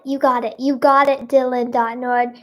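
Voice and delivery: ordinary delivery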